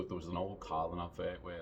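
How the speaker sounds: ordinary delivery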